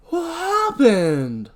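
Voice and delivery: high, drawn-out, loud cry; alarmed question. Acted. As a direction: demand to know, shocked by what you see. Dan finds body in basement.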